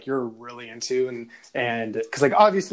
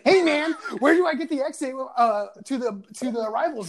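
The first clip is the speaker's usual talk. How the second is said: higher mocking voice for the guy asking directions; imitating Wee Man; 9 semitones higher than usual. Retelling meeting Wee Man at the airport, high mocking voice. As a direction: mimic him asking the way to arrivals. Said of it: "And he's like".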